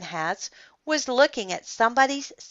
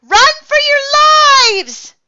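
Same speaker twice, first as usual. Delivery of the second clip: high, slow, drawn-out panicked scream; loud alarm cry, stretched out; shouting; 12 dB louder, slower than usual. A narrator's reading. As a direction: yell a warning to the whole crowd. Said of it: "shouted Neil".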